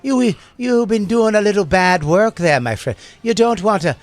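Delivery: high, loud Irish vigilante voice; stage Irish brogue warning a criminal; Irish accent. Pretend. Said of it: "I do it in an Irish accent".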